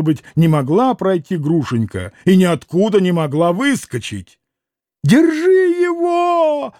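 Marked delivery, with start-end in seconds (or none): 5.0-6.7 put-on high, slow squealing old man; old man's squeal. A narrator's reading. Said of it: "завизжал Фёдор Павлович".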